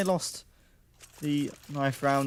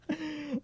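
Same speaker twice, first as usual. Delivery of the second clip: brief high-pitched real chuckle, softer; short high laugh; 13 semitones higher, 8 dB quieter than usual. Real, not acted. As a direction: laugh it off quickly. Still laughing at chat comment.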